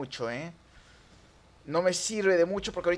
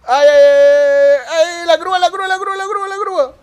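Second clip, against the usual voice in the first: alarmed yelling; 12 semitones higher, 12 dB louder than usual. Real, not acted. Player spotting the tow truck coming, alarmed high yell. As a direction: yell a panicked warning.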